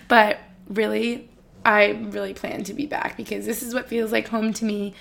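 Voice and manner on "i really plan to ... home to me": usual speaking voice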